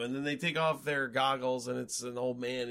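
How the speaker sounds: ordinary talk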